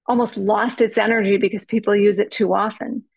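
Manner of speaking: ordinary talk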